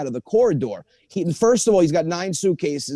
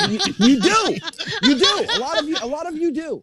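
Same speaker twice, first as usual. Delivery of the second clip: laughing retort; 9 semitones higher than usual. Retold or imitated. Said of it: "I'm like".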